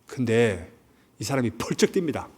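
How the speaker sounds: usual speaking voice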